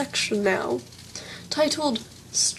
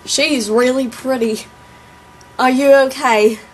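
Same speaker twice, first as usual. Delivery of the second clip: put-on southern accent; 7 dB louder than usual. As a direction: imitate a southern guy, drawling it out. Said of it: "I can't even do my own southern accent".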